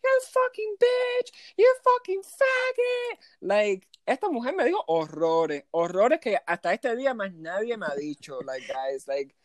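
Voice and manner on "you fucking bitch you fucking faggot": slow screeched insults, imitating an irate guest; imitated screaming. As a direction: mimic her drawn-out, very high screams. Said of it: "She starts screaming at me".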